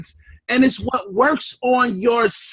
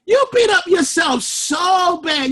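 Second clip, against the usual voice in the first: normal talking voice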